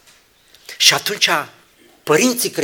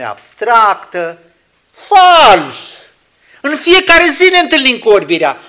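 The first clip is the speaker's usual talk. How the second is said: high, loud fervent rebuke; full-force preaching; 9 semitones higher, 14 dB louder than usual. Acted. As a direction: denounce it.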